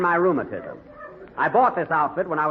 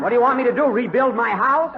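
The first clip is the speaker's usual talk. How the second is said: exasperated outburst; 7 semitones higher, 4 dB louder than usual. Acted. Boss exasperated at servant.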